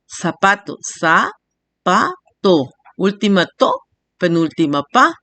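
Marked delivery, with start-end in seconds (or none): 0.8-2.6 "za, pa, to" split by clear pauses; each of "za, pa, to" as a separate beat; syllable by syllable. Shown as a demonstration. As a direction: sound out "za", "pa", "to" one at a time. Teacher splits word into syllables.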